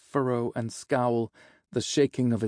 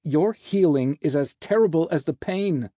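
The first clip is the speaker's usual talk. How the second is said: loud, higher-pitched complaint; biting retort; 4 semitones higher, 5 dB louder than usual. Acted. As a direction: snap back through the pain. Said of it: "Azazel bit back".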